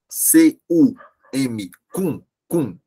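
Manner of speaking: C U M spelled letter by letter, then cum, cum stressed; slowly spelled out. Shown as a demonstration. Teacher spelling cum, cum slowly for the class. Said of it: "É cumprimentou com o".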